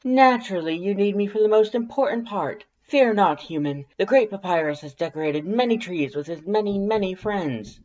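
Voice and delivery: high, loud, boastful skeleton voice; crowing Papyrus. Acted. Papyrus boasting to the human about decorating trees, high loud crowing. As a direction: puff up and crow every word grandly. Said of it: "Papyrus crows".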